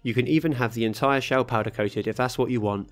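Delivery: ordinary talk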